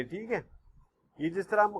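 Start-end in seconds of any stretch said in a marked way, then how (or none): none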